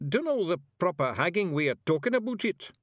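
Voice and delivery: high Scottish little-man voice; hesitant Scots warrior. A narrator's reading. Warrior lacks words to explain hiver, hesitant. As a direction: admit being at a loss in Scots.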